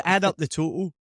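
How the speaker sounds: put-on "total" in Scottish accent with a swallowed middle t; retold teacher's line in Scottish accent. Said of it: "can't even understand what I'm saying".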